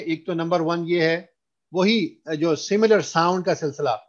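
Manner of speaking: normal talking voice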